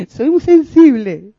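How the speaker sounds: mock her own drama, loud and drawn out; hammed-up sensitive wail. A preacher imitating her past self, slow, loud and dramatic. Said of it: "era dramática total".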